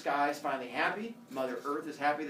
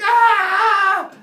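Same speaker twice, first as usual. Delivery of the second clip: very high, loud scream; comic pain scream; 18 dB louder than usual. Acted. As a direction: scream as if just stabbed, hammed up. Father Sky stabbed by spear.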